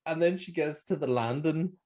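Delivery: normal talking voice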